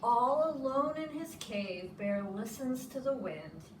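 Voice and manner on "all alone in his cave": plain reading voice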